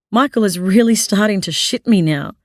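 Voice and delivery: usual speaking voice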